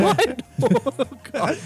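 high squeaky laugh. Real, not acted. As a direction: laugh off the embarrassment. Embarrassed laughter.

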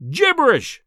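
high, loud burst of scorn; indignant cry. A narrator's reading. Professor dismissing the journalist's talk, crying out angrily. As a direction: cry it out in anger. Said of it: "he cried".

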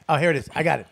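ordinary talk

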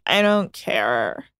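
low, slow and bored; apathetic shrug. Retold or imitated. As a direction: drag it out like you've given up. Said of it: "to being like".

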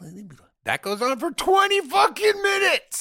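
high, loud outburst; exasperated shout. Acted. Moviegoer complaining about a long scene, exasperated shout. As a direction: yell it in comic exasperation. Exasperated punchline after the impression.

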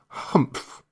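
breathy, unvoiced disdainful grunt; a lazy camel snorting contempt; grumpy camel humph.

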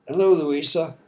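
lightly voiced Southern accent, friendly greeting. A narrator's reading. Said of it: "in her warm, never polite Southern accent".

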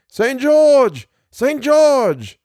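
high, drawn-out rallying cry; battle shout. A narrator's reading. Thousands answer the summons, shouting high. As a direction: shout it like a host of thousands, pitch leaping up. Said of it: "thousands shouting".

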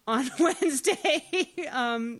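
loud, high laugh through the word; giggling confession. Real, not acted. Laughs admitting a hangover.

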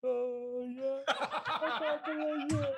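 mock overwhelmed, high and breaking into a laugh; hammed-up emotion. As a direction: overplay the feelings with a laugh. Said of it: "let's just try the emotional one".

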